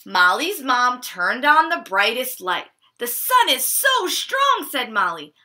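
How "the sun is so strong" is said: child pretending the lamp is the summer sun; pretend sun glare. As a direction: ham it up as if the sun blinds her. Pretending indoor light is sun.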